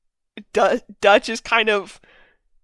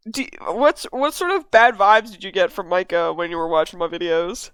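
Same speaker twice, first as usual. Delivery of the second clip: ordinary delivery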